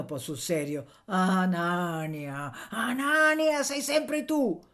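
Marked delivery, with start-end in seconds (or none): 1.1-2.7 name drawn out very slowly; reproachful. A narrator's reading. Woman reproaching the miller by name, drawn-out. 2.7-4.6 high, loud exclamation; exclaiming reproach. A narrator's reading. Woman scolding the miller, high exclamation.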